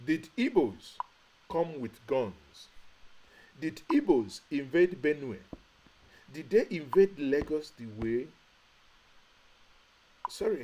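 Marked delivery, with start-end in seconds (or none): 0.1-8.3 rhetorical challenge. Real, not acted. Angry rhetorical questions to audience.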